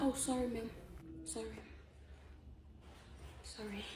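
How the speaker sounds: sheepish apology. Acted. Caught chewing, apologising softly.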